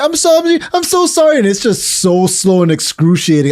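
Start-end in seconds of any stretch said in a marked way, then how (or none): 0.0-1.4 high, frantic apologizing voice; mock apology. Woman caught on the toilet, apologizing in a high panic. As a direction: squeak out a flustered apology. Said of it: "And they're saying".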